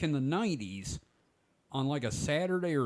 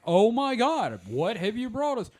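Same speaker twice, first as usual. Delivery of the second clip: higher, louder, a mock-amazed infomercial cohost; hammed-up infomercial gasp; 8 semitones higher, 6 dB louder than usual. Mocking late-night infomercials to friends, a louder, hammed-up amazed gasp. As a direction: gush like a stunned TV sidekick. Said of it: "the dude in the Cosby sweater".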